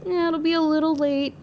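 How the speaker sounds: high, sheepish, sweet-talking it; sheepish admission. Retold or imitated. Artist tells manager her pieces will be late, sheepish. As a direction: soften bad news with a high sweet voice.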